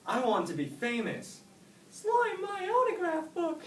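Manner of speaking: high, eager little snail voice; squeaky and excited, dreaming big; young snail bursting with hopes; Oscar the snail. A narrator's reading.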